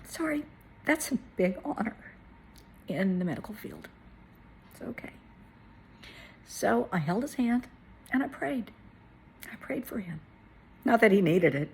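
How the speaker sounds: choked up, apologetic and halting; holding back tears. Real, not acted. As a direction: push on through real tears. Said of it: "I'm trying not to cry here".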